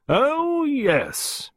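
very high, hearty character voice; robust lady gardener. A narrator's reading. Miss Peake replying to Costello, robust.

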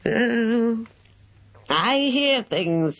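put-on high, slow sly old man's voice; a hint of a whinnying cackle; amused old man. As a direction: gloat slyly, still chuckling. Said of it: "a high whinnying laugh of intense amusement".

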